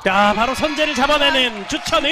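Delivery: loud, high-pitched call of the point; excited match call. Real, not acted. Commentator hailing the player's attack, excited shout. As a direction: shout it out as the rally is won.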